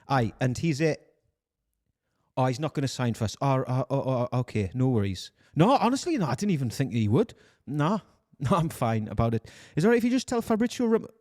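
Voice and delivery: resigned Geordie phone voice, lifting towards the end; Newcastle accent. Acted. Recruiter taking a rejection by phone, Newcastle accent. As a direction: keep up a brave-face Geordie accent. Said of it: "Newcastle accent than this".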